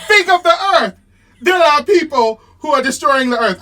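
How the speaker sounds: loud, high French accent impression. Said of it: "I don't have a French accent".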